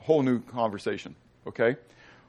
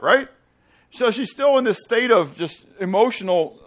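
ordinary talk

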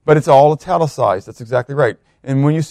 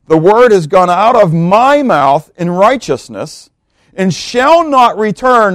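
loud, slow declaration, pitched up; emphatic scripture reading; 5 semitones higher, 5 dB louder, slower than usual. Acted. Preacher declaring God's sworn word to congregation, emphatic and slow. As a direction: proclaim each phrase with weight, stretched out. Reads scripture aloud with force.

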